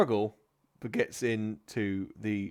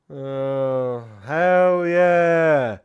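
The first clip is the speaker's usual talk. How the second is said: crowd cheer; 4 semitones higher, 12 dB louder, much slower than usual. Acted. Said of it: "the crowd are like".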